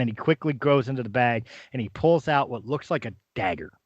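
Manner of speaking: normal talking voice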